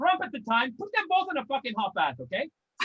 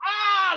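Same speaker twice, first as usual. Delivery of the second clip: louder pained "Ah!"; a sudden yell of pain, mock version; a mock cry of sudden pain; retold yell; 5 semitones higher, 5 dB louder than usual. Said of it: "I just had this real big yell".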